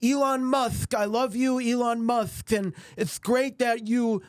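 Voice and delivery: high lisping cartoon cat voice; Sylvester lisp impression. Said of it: "he sounded like ... Sylvester".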